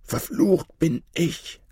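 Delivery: slow, high wail of despair; despairing cry. A narrator's reading. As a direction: curse yourself, stretching each word high. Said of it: "rief er".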